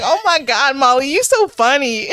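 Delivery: gushing fan in a put-on Georgia accent. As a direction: friend laughing along in a Georgia accent. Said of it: "Her accent is so thick".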